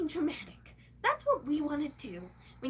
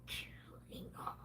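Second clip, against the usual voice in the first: whispered aside, unvoiced; conspiratorial whisper about her mother; 12 dB quieter, slower than usual. Real, not acted. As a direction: drop to a hushed whisper. Dropped voice aside.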